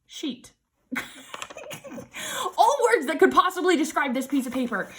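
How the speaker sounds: ordinary talk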